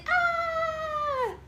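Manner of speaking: high drawn-out mock squeal; scared piggy squeal. Mother prompts her toddler to copy the piggy, mock-scared squeal. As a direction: squeal in pretend fright. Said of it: "When the piggy's scared, he goes".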